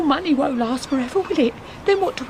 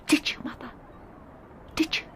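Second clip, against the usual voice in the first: whisper; 10 dB quieter than usual. Acted. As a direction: whisper it, barely voiced. Doubting daughter presses her mother.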